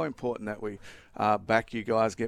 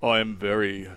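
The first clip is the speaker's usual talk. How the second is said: lightly put-on deep bass voice; a hint of a crooner's deep voice. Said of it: "in the deepest voice you can do".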